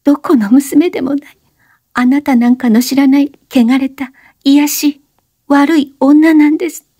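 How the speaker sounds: put-on high young woman's voice, urgent plea; breathless high-pitched plea; Oshino, pleading. A narrator's reading. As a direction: beg him to stop talking. Oshino confessing.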